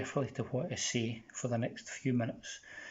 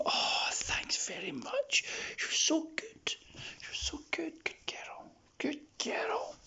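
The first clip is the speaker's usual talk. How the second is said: whispered praise; 3 dB quieter, slower than usual. Real, not acted. Owner praising her dog Molly, soft whisper. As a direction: hush the praise to a calm dog.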